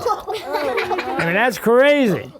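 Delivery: laughing through the words. Real, not acted. Teller laughing at Santa's silly suit picture, laughing. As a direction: chuckle it out, amused.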